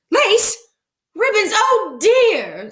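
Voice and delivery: very high, loud astonished cries; shocked disbelief. Acted. Mrs Rogers opens the ice box, squeaking very high in shock. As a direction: squeak out each word in disbelief.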